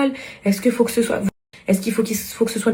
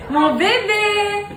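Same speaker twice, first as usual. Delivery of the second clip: tendre appel; 8 semitones higher, much slower than usual. Real, not acted. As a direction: coo it, high and drawn out. Mère appelle tendrement son enfant. (appel tendre et aigu à son bébé)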